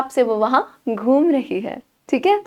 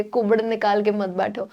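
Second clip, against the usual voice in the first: usual speaking voice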